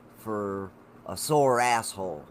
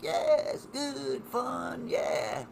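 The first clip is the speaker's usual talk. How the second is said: mock carefree; 13 semitones higher, much slower than usual. Mocks lazy enjoyment of good things.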